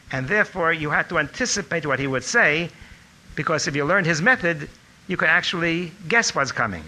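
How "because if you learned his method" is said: ordinary talk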